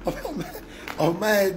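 words breaking into high laughter; laughing. Real, not acted. Speaker reacting to the situation, laughing. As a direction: laugh through the exclamation.

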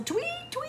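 high piping whistle imitation; whistle tweet. A narrator's reading. Narrator imitating the coach's whistle, high and piping.

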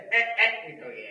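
a slow, loud drawn "ए"; drawn-out ah sound. Shown as a demonstration. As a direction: stretch out "ए" as a first sound breaking free. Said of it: "एंग नहीं, ए". (लंबा खिंचा हुआ "ए")

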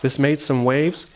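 usual speaking voice

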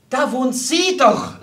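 high, loud, drawn-out cry; excited, slow and emphatic; agitated exclamation. A narrator's reading. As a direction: call out in excitement. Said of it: "rief Fräulein Borg aufgeregt".

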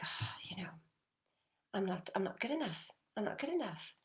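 soft, whispered self-doubt; mock self-doubt murmur. Coach voicing listeners' self-doubt, hushed and small. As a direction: murmur it like a nagging inner voice.

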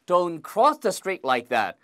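ordinary talk, nothing marked

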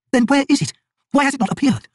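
frightened woman pressing for the truth; trembling alarm. A narrator's reading. Kilava, trembling, demands the Bonecaster explain, high and loud.

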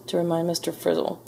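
plain reading voice

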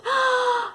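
sharp high blowing gasp; a big huffing breath. Shown as a demonstration. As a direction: blow out hard like the pig. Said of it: "here's your part".

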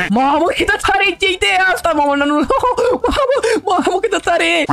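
high, laughing with glee; bursting with excitement at an idea; giddy high laugh over a great idea; overexcited. Real, not acted. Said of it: "sunt prea entuziasmat".

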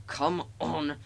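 words in effortful puffs; puffing. A narrator's reading. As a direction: chug out each word like an engine. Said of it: "he puffed".

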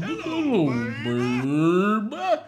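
high swoop on hello, crooned babe; catchphrase impression. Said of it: "loves his own phrase".

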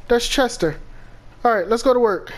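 ordinary talk, nothing marked

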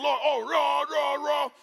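high, loud wordless worship wail; mock worship roar. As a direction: belt out roars like a fired-up worshipper. Imitating fiery worship singing.

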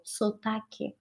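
barely voiced murmur; near-silent translation aside; quiet aside. Real, not acted. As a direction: slip the Portuguese word in under your breath. Portuguese gloss, teacher aside.

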